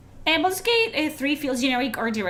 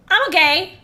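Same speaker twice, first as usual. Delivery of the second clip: "I'm okay" much louder and higher, rising; 6 semitones higher, 9 dB louder than usual. Real, not acted. Streamer loudly insists to chat that he's fine. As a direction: belt out "I'm okay" with a high rise.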